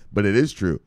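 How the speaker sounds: ordinary delivery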